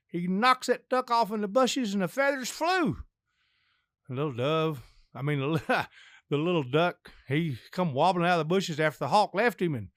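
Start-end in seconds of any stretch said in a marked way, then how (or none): none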